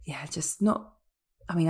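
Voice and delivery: soft unvoiced whisper. Real, not acted. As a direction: whisper to save a sore throat. Ill guest sparing her voice.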